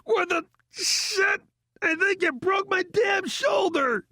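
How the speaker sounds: very high, agonized yelling; high-pitched howl of outrage and pain; pained scream. A narrator's reading. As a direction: scream it through sudden injury. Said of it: "he screamed".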